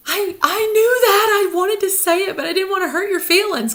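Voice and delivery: high, loud, gushing confession; excited sister. Retold or imitated. Sister admitting she noticed but held back, high loud gush. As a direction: blurt it out, thrilled and relieved. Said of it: "And my sister was like".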